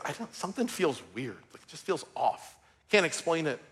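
soft, low, uneasy voice; quietly voicing the wife's worry; a hushed, uneasy report of something wrong; worried hush. Wife quietly says something is wrong.

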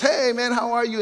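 a hollow, put-on greeting; fake friendly greeting. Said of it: "It's not just".

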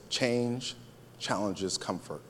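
"Change", a pause, then "challenges comfort" low and soft; hushed key point. Shown as a demonstration. Preacher asking congregation to catch this, hushed and deliberate. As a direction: drop "challenges comfort" softly after a pause.

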